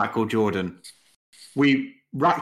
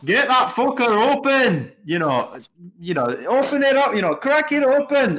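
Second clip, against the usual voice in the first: high, loud, slow Scottish yell at the bookie; Scottish man shouting; 13 semitones higher, 6 dB louder than usual. Retold or imitated. Mates retelling a viral clip, imitating a Scottish punter's loud high yell. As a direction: bellow it like a drunk Scot demanding the safe. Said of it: "a Scottish man going into a Ladbrokes, going".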